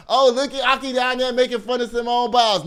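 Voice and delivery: mock accusation. Host mimics listeners accusing her, mock accusation. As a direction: imitate listeners calling you out.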